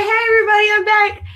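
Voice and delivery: very high, loud mock greeting; returning steward. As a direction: imitate a steward announcing his return. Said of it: "And he's like".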